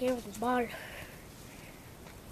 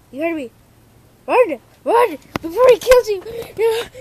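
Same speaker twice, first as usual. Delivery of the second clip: loud, higher hammed-up scream; mock warning yell; 9 semitones higher, 16 dB louder than usual. Vlogger warning viewers to flee the dog, hammed-up loud scream. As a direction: shout the warning like a horror movie.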